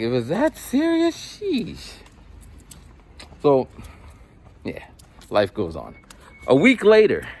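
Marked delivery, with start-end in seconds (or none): 0.0-1.9 high, mock-exasperated brush-off; teacher brushes off the sulking kid, high and mocking; dismissive sheesh. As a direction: shrug it off in a high voice. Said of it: "I'm like".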